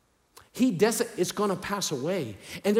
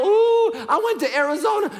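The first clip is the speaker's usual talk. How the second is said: much higher, louder thrilled cry; mock excited shout; 13 semitones higher, 7 dB louder than usual. Preacher mocking small travel thrills with a high excited shout. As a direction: shout it like a giddy road-tripper. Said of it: "and go".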